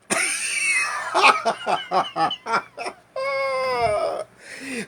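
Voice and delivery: high, loud howling laugh; shrieking laugh. Real, not acted. Teller cracking up at his own story, shrieking laugh.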